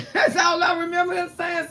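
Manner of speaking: usual speaking voice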